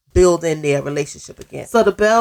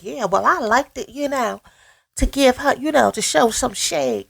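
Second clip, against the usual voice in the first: mocking quote; 7 semitones higher than usual. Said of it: "So Gucci was like".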